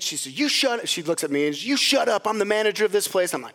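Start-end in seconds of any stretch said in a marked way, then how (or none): 0.4-0.9 high-pitched mock snap; agitated retort. Imitating agitated manager to mother-in-law, high mock retort. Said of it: "she's getting agitated". 1.7-3.3 high, quick mock-bossy retort; agitated retort. Imitating manager turning on the speaker, agitated retort. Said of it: "she's getting agitated".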